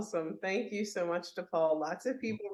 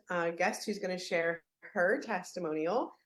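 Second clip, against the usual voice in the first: ordinary talk, nothing marked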